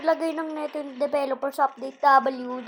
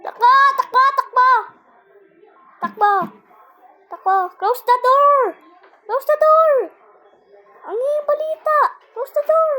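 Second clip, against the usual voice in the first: panicked high shouting; while running; 11 semitones higher, 12 dB louder than usual. Real, not acted. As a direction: yell in panic while fleeing. Fleeing monster in game.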